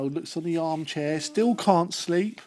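plain reading voice

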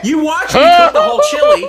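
high, loud indignant shout. Real, not acted. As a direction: yell it in protest. Defending her cooking, shouted.

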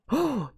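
sudden high gasp of surprise; sharp gasp. Real, not acted. Spots dropped bow in cave.

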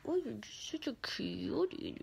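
soft whispered doting. Real, not acted. Whispering sweet nothings to the pet. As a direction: coo it under your breath.

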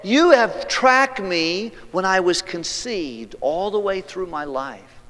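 high, loud, slow-building awe; rapturous psalmist. Acted. Preacher voicing the psalmist in soaring praise. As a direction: pour out wonder at being known and loved. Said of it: "Then he said".

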